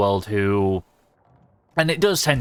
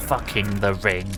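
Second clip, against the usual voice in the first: normal talking voice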